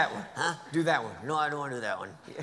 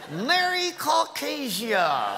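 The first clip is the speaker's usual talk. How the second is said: festive greeting; 6 semitones higher, much slower than usual. Shown as a demonstration.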